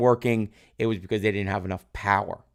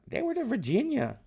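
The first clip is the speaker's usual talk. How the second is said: high-pitched, softer Southern imitation; put-on Southern accent, pitched way up; 14 semitones higher, 5 dB quieter than usual. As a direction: mimic a student in a high Southern twang. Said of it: "he's talking with a Southern accent".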